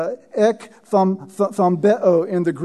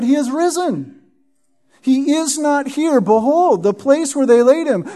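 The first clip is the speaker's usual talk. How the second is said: higher, lifted angel voice proclaiming; raised proclamation of the risen Lord; angel speaking; 5 semitones higher than usual. Acted. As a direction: announce it like the angel at the tomb. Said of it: "And he said to them".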